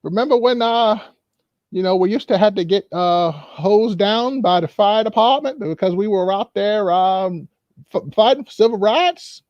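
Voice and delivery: high, nostalgic elder's voice; high, lilting elderly reminiscence; old-timer reminiscing. Acted. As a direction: recall the old struggle like a proud old-timer. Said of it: "they probably sat back like".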